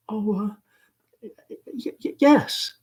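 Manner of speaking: whispered stammer; shy girl flustered by the caller; thin, breathless. Acted. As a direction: gasp out a yes. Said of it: "Laura's voice is thin and breathless".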